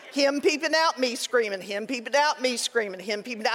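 a storyteller's sing-song litany that fades out; fading rhythmic list. Retold or imitated. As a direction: let the chant die away. Rhythmic retelling of repeated chase.

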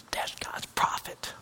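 slow, hushed wonder; awed whisper. Pretend. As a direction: whisper it in awe.

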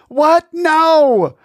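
high squeaky outburst; horrified yelp. Acted. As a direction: shriek a horrified refusal. Brother refuses in horror.

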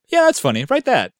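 high, loud, careless chuckle voice; goofy writer voice. Retold or imitated. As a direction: toss it off like a lazy writer. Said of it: "did they sit down and go".

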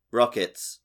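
ordinary talk, nothing marked

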